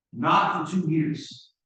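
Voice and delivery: ordinary talk, nothing marked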